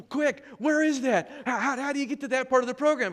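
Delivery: high, loud, eager rush of questions; urgent excited. Retold or imitated. President eager to find the fix, asking in an excited high rush.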